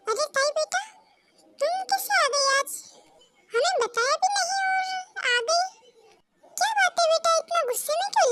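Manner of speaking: a father puzzled that his daughter turned up unannounced; father asking his daughter why she came; surprised father. Acted. Father greets arriving daughter.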